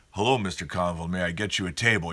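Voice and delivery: a smooth, hushed steakhouse host; fancy host greeting. Retold or imitated. Steakhouse host greets a known regular, low and hushed. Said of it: "they're like".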